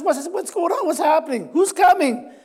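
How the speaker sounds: eager questions. Acted. Blind man hearing commotion.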